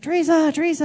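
calling out. Retold or imitated. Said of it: "he's like".

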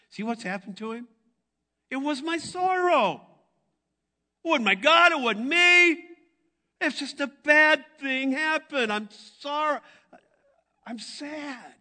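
high-pitched, loud emotional preaching; impassioned preaching. Real, not acted. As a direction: pour out the sorrow at full force. High intense climax.

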